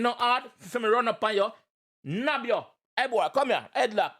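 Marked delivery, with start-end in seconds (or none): none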